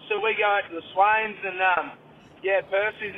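ordinary talk

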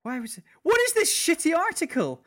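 loud, high frustrated outburst; exasperated. Real, not acted. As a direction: rant at the useless article. Mock outrage at article.

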